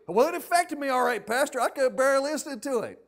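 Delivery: loud, high-pitched mock complaint; indignant comeback. Acted angry comeback.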